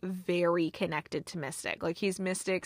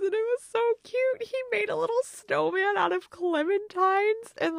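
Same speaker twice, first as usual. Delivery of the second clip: much higher, delighted gushing; gushing over cute; 11 semitones higher than usual. Real, not acted. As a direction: squeal about how adorable it was. Gushing over cute penguin scene.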